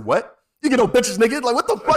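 loud, high flare-up; mock outburst. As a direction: explode into an exaggerated insult. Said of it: "it's just like".

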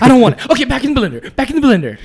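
high-pitched, hammed-up movie dialogue; movie quote impression. Quoting that movie for friends, high comic voice. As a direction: throw it out high, playing it for laughs.

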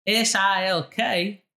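exaggerated Southern twang; Southern accent. Shown as a demonstration. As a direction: show how a Southerner would draw out each letter. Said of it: "Southern would be like".

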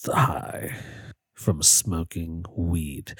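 low, slow, half-whispered high talk; stoned. Real, not acted. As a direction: mumble it out baked and slow. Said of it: "I'm really high right now".

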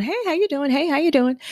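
bright high greeting to everyone she passes; cheery greeting. Retold or imitated. Walking into the salon greeting everyone, high and bright. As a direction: sing out a friendly hello. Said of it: "I'm the, "Hey, how you doing?".

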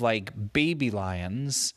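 plain reading voice

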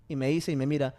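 normal talking voice

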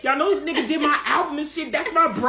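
loud, high indignant imitation. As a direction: protest loudly as the name-dropped singer. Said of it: "[name] be like".

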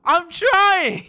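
high, loud yell back; exasperated shout. Real, not acted. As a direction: holler it in flustered protest. Flustered host yells back.

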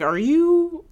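high, loud questioning; incredulous high-pitched question; mock disbelief. Acting out her disbelief at accents.

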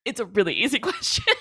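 can't help giggling while making the point; amused, laughing. Real, not acted. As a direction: laugh through the words. Laughing at the film's victim.

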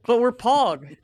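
put-on high, loud whiny kid; bratty kid voice. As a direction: whine it like a cocky boy. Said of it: "and he's like".

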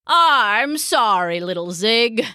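put-on slow, drawn-out old-man apology; apologetic Papa. A narrator's reading. Papa makes up with his cat, apologetic. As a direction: draw out the sorry as contrite Papa.

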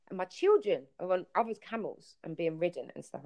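ordinary talk

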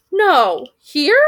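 high, loud squawk of surprise; a high, startled raven; surprised raven. Acted. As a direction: jolt up in disbelief. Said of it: "said the second".

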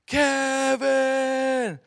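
long drawn-out, high-pitched call; dad shouting. Retold or imitated. Dad calling out to his lost child, shouting. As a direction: holler the name across the woods. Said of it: "heard my dad's voice shouting out to me".

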